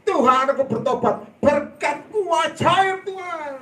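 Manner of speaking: high, loud, drawn-out prayer, slowing on the middle line; fervent prayer cry. Retold or imitated. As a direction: cry out to God in rapture. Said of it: "Saya bilang". (seruan doa yang lantang, tinggi dan lambat)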